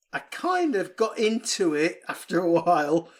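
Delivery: ordinary talk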